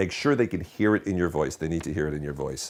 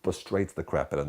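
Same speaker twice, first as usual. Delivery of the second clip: usual speaking voice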